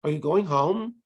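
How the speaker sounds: "Are you going home" with the voice going up on "home"; rising question intonation. Shown as a demonstration. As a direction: lift the end of "home" as a yes-no question. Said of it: "when it's a yes, no question".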